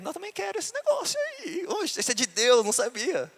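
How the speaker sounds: put-on squeaky eager kid wanting the gift; high childish eager voice. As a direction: pitch way up, pleading like a boy. Repete o pedido em voz infantil. (voz aguda de criança ansiosa)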